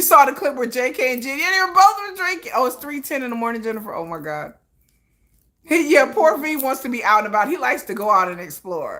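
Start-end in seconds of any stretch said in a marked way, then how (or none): none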